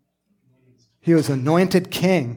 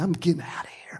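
breathy whisper, backing off; scared whisper of giving up; whispered retreat; 7 dB quieter, faster than usual. Pretend. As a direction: whisper it like you're sneaking away. Meek retreat, whispered aside.

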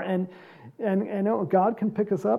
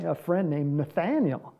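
ordinary talk

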